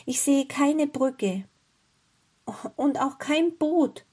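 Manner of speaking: put-on high, slow, worried boy; slow, high-pitched dismay; troubled boy. A narrator's reading. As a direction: draw it out high, as a worried child. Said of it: "sagte Hänsel bekümmert".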